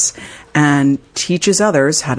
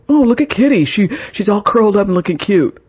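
higher cooing mock husband voice; mimicking husband; 5 semitones higher than usual. Wife imitating husband cooing at the cat mid-sentence. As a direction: parody him gushing over the cat. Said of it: "he makes a comment about the cat".

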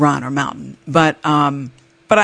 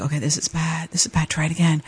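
quiet self-talk; 8 dB quieter than usual. Retold or imitated. Stuck skier coaching herself to retry, quiet self-talk. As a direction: say it under your voice, to yourself.

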